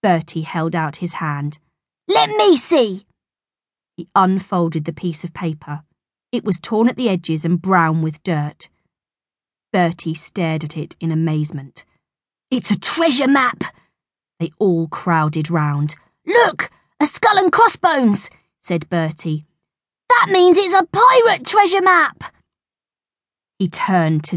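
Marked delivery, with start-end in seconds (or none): 2.1-3.1 put-on boy voice. Bertie voiced in story. 12.5-13.6 amazed. Acted. Bertie amazed. 16.2-18.3 put-on boy voice. Bertie voiced in story. 20.1-22.3 put-on excited boy. Bertie excited.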